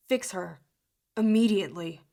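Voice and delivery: loud, cutting order; angry command. Acted. Jude ordering the boy to lift the curse, angry and loud. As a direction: bark the last word like a blade.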